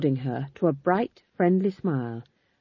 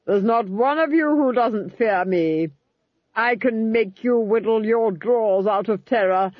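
higher, slow, menacing triumph; gloating Duchess; 5 semitones higher, 3 dB louder, slower than usual. Acted. Duchess gloating over the frightened crowd, high and menacing. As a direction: savour every word of the threat.

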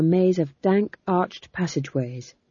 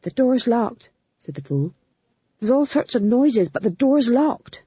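higher, fretting voice; a nervous little man; worried fool; 6 semitones higher than usual. Acted. The fool tells Magrat the door is locked, higher.

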